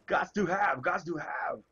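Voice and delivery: high, sing-song gots to have; playful catchphrase. Pretend. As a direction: toss it off like a slogan. Playful catchphrase about phones.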